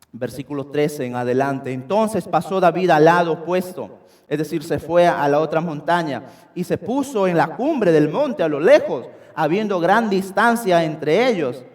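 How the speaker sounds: ordinary delivery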